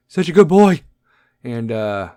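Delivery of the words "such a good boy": high, loud, gushing put-on voice; mock sentimental owner. Mocking a grieving owner rushing to replace his dog, gushing. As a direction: ham up the teary new-puppy buyer. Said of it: "be like".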